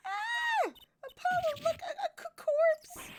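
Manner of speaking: very high, loud screaming; terrified scream. Acted. Girl spotting a corpse, high loud screams. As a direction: shriek at the body.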